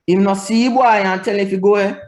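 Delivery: put-on HIM NO SEE BOY high and held up, GO AWAY rising; contrasting intonation. Shown as a demonstration. Teacher contrasting meanings of same words, high rising intonation. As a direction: keep it all high, lifting AWAY like a question. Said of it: "Different intonation".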